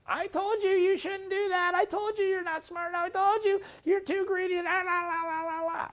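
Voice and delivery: very high, squeaky nagging mother voice; cartoonish shrill scolding, dissolving into babble. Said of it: "This one actually is my mother".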